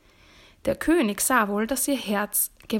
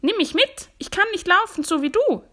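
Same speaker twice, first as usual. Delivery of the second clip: high, loud frog plea; pleading frog; 10 semitones higher, 5 dB louder than usual. A narrator's reading. Frog begging the departing princess, high and pleading. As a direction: cry out high, begging not to be left. Frog begs to come along.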